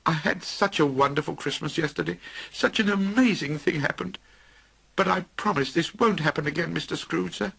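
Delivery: high, anxious explaining; nervous clerk. Acted. Bob explains himself to Scrooge, anxious. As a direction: talk fast to keep his job.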